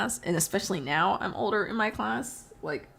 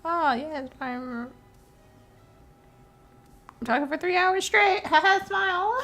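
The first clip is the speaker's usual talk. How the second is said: high, fake-bright streamer voice; mock cheerful; 9 semitones higher than usual. Mocking herself to viewers, in a fake cheerful voice. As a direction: ham up a forced happy act.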